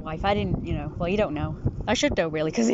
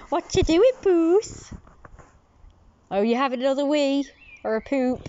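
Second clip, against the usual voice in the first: cat talk; 5 semitones higher, 3 dB louder than usual. Real, not acted. Cooing at a cat.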